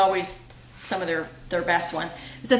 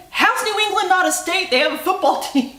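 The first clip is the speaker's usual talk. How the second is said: high, exasperated Ross voice; quoting Ross; 9 semitones higher, 4 dB louder than usual. Retold or imitated. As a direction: laugh through the disbelief. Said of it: "He's like".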